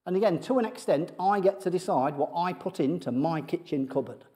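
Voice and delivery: ordinary delivery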